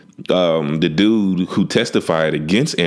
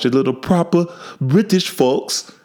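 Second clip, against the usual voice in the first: a quick, badly faked posh British accent, pitched higher; hammed-up fake English accent; terrible British accent; 8 semitones higher than usual. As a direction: toss off a mock proper British voice as a joke. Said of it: "that's a terrible British accent".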